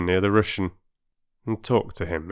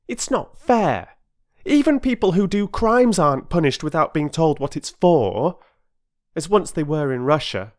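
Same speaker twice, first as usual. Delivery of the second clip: put-on high, loud protesting boy; a boy standing up for fairness; indignant boy Peter; 9 semitones higher, 4 dB louder than usual. Peter to the porter, protesting indignantly.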